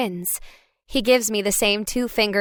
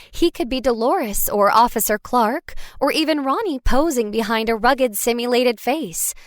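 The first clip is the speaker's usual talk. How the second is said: usual speaking voice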